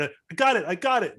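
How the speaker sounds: high, urgent exclamations; frantic triumph. Acted. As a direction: announce the fix in a rush of relief. Pilot fixing systems under pressure.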